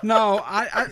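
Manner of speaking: high, loud burst of laughter. Real, not acted. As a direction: crack up laughing.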